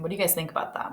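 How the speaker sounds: ordinary delivery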